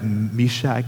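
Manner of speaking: ordinary talk, nothing marked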